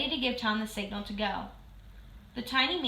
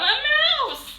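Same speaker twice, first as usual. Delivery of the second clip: high, loud cry of alarm; frightened shout; 11 dB louder than usual. A narrator's reading. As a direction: yell it out, scared stiff. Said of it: "shouted Missus Newcomb".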